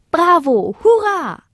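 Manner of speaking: high-pitched jubilant cheers; cries of joy. A narrator's reading. Little stars greet the newcomers, cries of joy. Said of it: "allerlei Freudenrufe".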